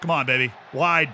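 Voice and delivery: ordinary talk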